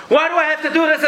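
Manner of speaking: put-on high whiny teenage complaint; whiny teen son. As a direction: whine like a put-upon teenager. Son whining back at parent.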